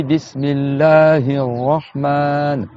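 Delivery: Bismillahir Rahman, slow, joined without a break; linked recitation. Shown as a demonstration. Teacher showing students Bismillahir Rahman slowly linked. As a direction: glide through Bismillahir Rahman with long vowels. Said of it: "quand tu fais la liaison".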